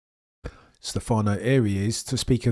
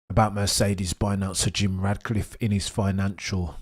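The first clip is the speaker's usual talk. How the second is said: talking while eating; mouth full. Real, not acted. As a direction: speak through a bite of food. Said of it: "I apologise for eating".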